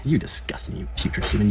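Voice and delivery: hushed, scornful mock insult; mock disgusted God. Joking God scolding humans, hushed and scornful. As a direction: sneer it in a breathy hush. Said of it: "sick sense of humor".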